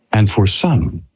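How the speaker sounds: ordinary talk, nothing marked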